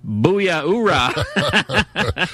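hearty Marine oorah with a laugh. Acted. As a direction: shout it like a proud Marine. Marine answers with battle cry.

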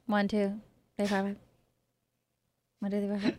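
quiet, breathy count into the mic; hushed mic check count; soft mic check. Shown as a demonstration. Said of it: "That's how the pros get started".

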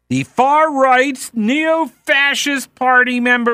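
high, drawn-out mocking emphasis; mock-alarmed label. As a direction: spell out each label with mock dread. Mocking a political label, then "whatever.

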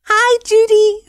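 very high, drawn-out singsong hello; high-voiced greeting. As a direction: put on a slow, piping greeting voice. Acted-out greeting to Judy.

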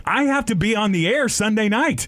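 high, urgent protest; sudden worry about missing work; alarmed protest. Retold or imitated. Stuck in the drunk tank, high alarmed protest. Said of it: "I was like".

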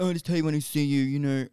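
lightly mocked boyfriend brushing her off; mocking boyfriend. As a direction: rattle off his brush-off in a mocking voice. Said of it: "he said that about fucking fifteen times".

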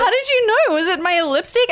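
high-pitched mock astonishment; total incredulity. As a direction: imitate a flabbergasted friend, pitch jumping up. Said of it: "like, total incredulity".

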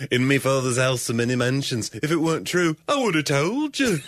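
put-on lilting Liverpool accent, laughing through. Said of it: "sound like [name] as Jesus".

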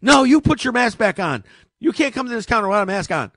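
loud, high indignant pushback; shouting back at the airline clerk; retold shout back. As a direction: fire the order right back at her. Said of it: "I said".

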